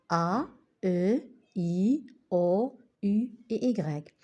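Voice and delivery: vowels named one by one, slowly; reciting vowels. Shown as a demonstration. Teacher reciting the French vowels. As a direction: list "A, E, I, O, U et Y" slowly and apart. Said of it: "six voyelles".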